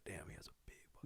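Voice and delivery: hushed, voiceless aside; imitated shocked whispering; mock whisper. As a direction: whisper it like gossiping onlookers. Imagined onlookers' hushed remark.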